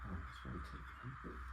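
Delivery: ordinary delivery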